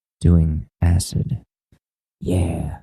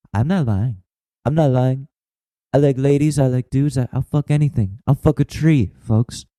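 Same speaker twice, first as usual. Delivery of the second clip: higher-pitched put-on speechifying voice; mock politician's address; 6 semitones higher than usual. Politician assuring folks about being bi, mock address. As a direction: insist to the crowd like a campaigning politician.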